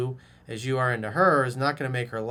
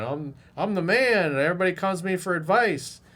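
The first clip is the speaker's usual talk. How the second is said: mock braggart; 5 semitones higher, 5 dB louder than usual. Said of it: "he's like".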